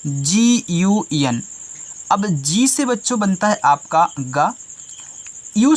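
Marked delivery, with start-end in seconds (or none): 0.1-1.4 letters spelled out slowly, one by one; slow spelling. Shown as a demonstration. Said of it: "क्या लिखा गया है". (अक्षर धीरे-धीरे बोलना)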